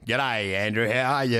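low-pitched mock Australian accent. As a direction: put on a deep Aussie greeting. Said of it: "dumped their accent".

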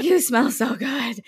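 dreamy swoon. Retold or imitated. Smitten at a cute interviewer.

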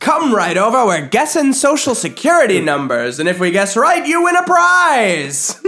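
high, loud pitchman call; carnival barker. Pretend. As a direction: hype the crowd like a huckster.

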